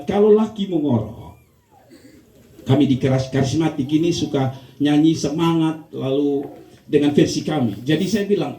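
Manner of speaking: usual speaking voice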